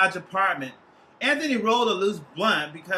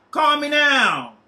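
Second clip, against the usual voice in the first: put-on slow, louder Jamaican accent, higher; 7 semitones higher, 7 dB louder, slower than usual. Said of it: "carried over by a Jamaican accent".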